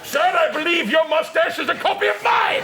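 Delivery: indignant shout. Acted. Acted indignant gent.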